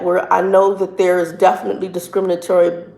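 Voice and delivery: normal talking voice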